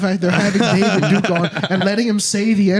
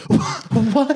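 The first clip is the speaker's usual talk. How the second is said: mock baffled what; 7 semitones higher than usual. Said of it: "Notice how he was like".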